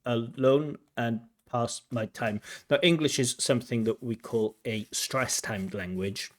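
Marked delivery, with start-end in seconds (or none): 0.0-2.4 slow, even beats on "I like to walk alone and pass my time"; teacher mimics the candidate's even rhythm; mechanical, syllable by syllable. Shown as a demonstration. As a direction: imitate a flat syllable-timed reading. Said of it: "pronunciation is mechanical".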